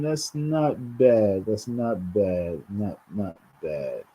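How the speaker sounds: slow, drawn-out, fading to quiet; slow musing. Real, not acted. A New Yorker musing slowly to folks that rent's not bad. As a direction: let it sink in, slowly trailing off. Musing over cheap rent.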